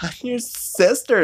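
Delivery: high sweet voice. Retold or imitated. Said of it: "she's like".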